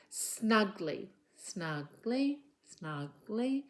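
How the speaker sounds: SNUGGLY in two beats, SNUG then LY; syllable by syllable. Shown as a demonstration. Teacher counting two syllables for a child, SNUGGLY broken into beats. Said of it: "two syllables".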